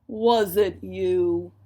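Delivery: slow, loud, accusing bear; big bear accusing someone; snarling bear. A narrator's reading. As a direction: growl the question slowly and loudly. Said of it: "he snarled".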